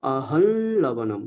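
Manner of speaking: আহল লবণম said slowly, nasal ল held; nasal l pronunciation. Shown as a demonstration. Teacher showing the correct pronunciation, slow. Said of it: "ওই কারণে উচ্চারণটা করব".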